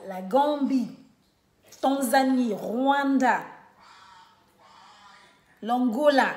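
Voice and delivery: ordinary talk, nothing marked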